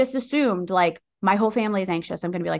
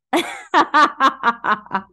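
high, loud burst of laughter; hearty laugh; 6 semitones higher, 6 dB louder than usual. Real, not acted. Laughing at her own story of the voice, hearty laugh.

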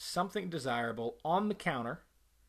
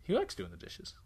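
mock realization; 10 semitones higher than usual. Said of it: "then you would go".

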